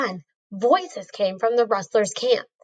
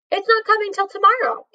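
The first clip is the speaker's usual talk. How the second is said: quick; 8 semitones higher, faster than usual. A narrator's reading. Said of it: "Annie said quickly".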